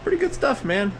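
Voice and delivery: very high, exaggerated mock voice; imitating the Sail North guy. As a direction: squeak it out like a goofy impression. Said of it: "I don't know if you guys heard this guy".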